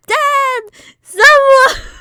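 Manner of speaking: high, loud cry for help; desperate yell. Retold or imitated. Begging dad or anyone for help with the bug, high crying scream. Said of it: "I was like".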